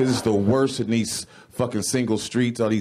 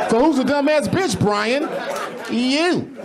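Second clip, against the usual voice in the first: self-accusing shout; 14 semitones higher, slower than usual. Acted. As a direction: point at yourself and yell. Calls himself out.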